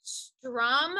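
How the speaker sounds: ordinary delivery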